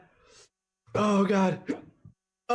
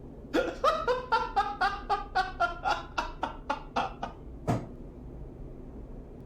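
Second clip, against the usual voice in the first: helpless laughter; 6 dB quieter than usual. Real, not acted. Streamer breaks up at chat bit.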